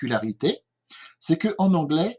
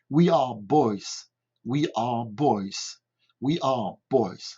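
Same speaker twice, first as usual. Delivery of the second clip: put-on "we are boys" said slowly and clearly, word by word; slow model repetition; 3 semitones lower, much slower than usual. Shown as a demonstration. Teacher repeats the phrase slowly for pupils. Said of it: "J'ai l'habitude de répéter trois fois".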